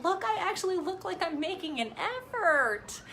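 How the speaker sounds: high, bright-eyed delight at her own lashes; thrilled high mock-gushing; excited self quote. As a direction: squeal it like you just saw yourself in the mirror. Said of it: "I'm like".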